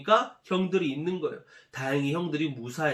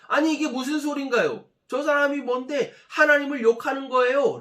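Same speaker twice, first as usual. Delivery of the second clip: higher, louder righteous protest; indignant outburst; 7 semitones higher, 6 dB louder than usual. Acted. Young David protests the giant's insults, indignant and loud. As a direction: flare up in holy anger. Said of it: "다윗이 발끈해서".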